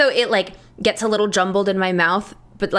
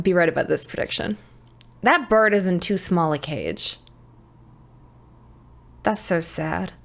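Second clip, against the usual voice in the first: ordinary talk, nothing marked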